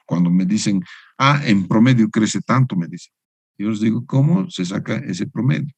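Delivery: ordinary delivery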